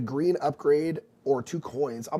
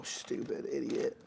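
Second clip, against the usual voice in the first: muttered to self; 10 dB quieter, slower than usual. Real, not acted.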